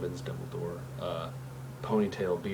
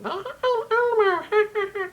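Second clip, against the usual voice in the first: very high, loud puppet voice; Elmo; 9 dB louder, much slower than usual. Shown as a demonstration. Showing viewers the throat trick, high puppet impression. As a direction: squeal it way up high in a throaty character voice. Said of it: "if you're sounding like Elmo".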